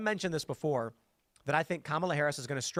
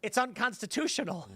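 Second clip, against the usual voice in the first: mock old Biden; 8 semitones higher than usual. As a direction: stretch it out in a mock old-man voice. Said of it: "And he goes".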